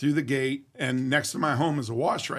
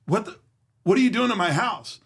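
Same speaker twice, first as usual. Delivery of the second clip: higher, louder alarmed challenge; alarmed demand; 6 semitones higher, 4 dB louder than usual. Retold or imitated. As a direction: demand it of the stranger. Confronting intruder in his house.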